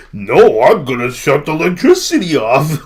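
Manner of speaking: very loud, high cartoonish bellow; mock stubborn politician. Imitating the premier refusing and threatening power, hammed-up high shout. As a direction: yell it like a blustering tantrum.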